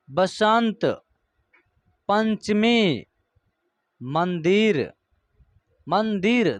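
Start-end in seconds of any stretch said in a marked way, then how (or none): none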